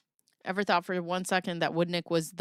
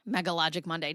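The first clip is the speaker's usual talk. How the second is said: ordinary talk, nothing marked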